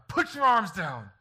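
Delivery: slow, high, very loud shout; drawn-out mock bellow of command; imitated yelled order. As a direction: holler it like an exasperated parent. Retold scolding of his defiant daughter.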